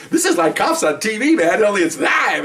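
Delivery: excited onlooker. Retold or imitated. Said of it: "She goes".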